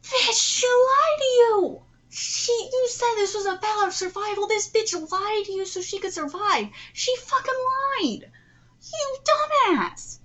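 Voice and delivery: high, loud outraged shouting; real screaming indignation; furious yelling. Real, not acted. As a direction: yell at the characters, stretching the key words. Outraged outburst at the characters.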